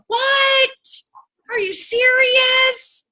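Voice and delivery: high, slow stretched-out "are you serious"; hammed-up astonishment, way up high; mock disbelief. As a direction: play up fake shock for the audience. Mock disbelief at normal offer.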